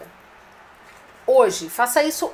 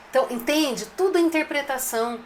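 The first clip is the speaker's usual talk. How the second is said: normal talking voice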